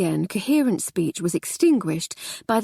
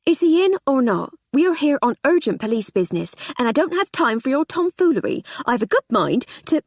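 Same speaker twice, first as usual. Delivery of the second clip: louder, higher impatient scolding; losing patience; 6 semitones higher, 4 dB louder than usual. Acted. Constable scolds the housekeeper, impatient. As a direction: snap at her, out of patience. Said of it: "Hancock was losing his patience".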